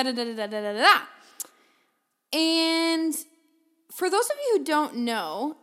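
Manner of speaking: ordinary talk, nothing marked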